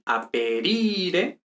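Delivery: "aperire" very slowly, the stressed second-to-last I stretched long and rising; acento en la I. Shown as a demonstration. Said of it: "respecto a la sílaba tónica, que es I". ("aperire" muy lento, alargando la I tónica)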